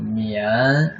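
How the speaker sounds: 绵 drawn out with a rising pitch; second tone. Shown as a demonstration. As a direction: stretch 绵 and glide it upward. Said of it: "Second tone".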